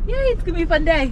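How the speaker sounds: bright, quick cheer; excited. Real, not acted. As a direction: cheer the day on, fast. Cheering start of family outing.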